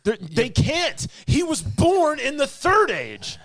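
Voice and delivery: high, slow exasperated protest. Real, not acted. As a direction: correct them in outraged disbelief. Outraged fan correcting rumor.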